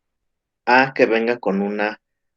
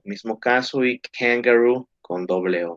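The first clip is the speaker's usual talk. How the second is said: ordinary talk, nothing marked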